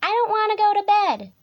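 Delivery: high, loud stubborn cub; protesting Little Tiger. A narrator's reading. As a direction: hold a high, defiant child's whine. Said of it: "said Little Tiger".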